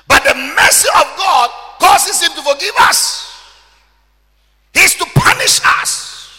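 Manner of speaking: loud, high, drawn-out preaching shout; full-force preaching. Acted. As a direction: thunder the scripture at the congregation. Preaching climax.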